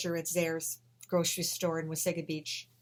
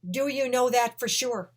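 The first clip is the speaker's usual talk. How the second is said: louder and higher, slowed and pressing; even louder; 6 semitones higher, 8 dB louder than usual. Retold or imitated. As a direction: push the question hard, loud and slow. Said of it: "the voice answered even louder".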